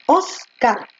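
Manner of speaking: "Oscar" with stress on the O; stress demo. Shown as a demonstration. Teacher showing students "Oscar" stressed on the O. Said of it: "Oscar lleva tilde en la O".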